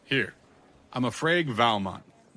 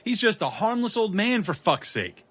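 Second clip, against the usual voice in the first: loud, high, urgent; urgent calming shout; 8 semitones higher, 4 dB louder than usual. Acted. Detective urging the police to ease up, loud and urgent. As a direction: rush in to stop them. Stopping armed cops.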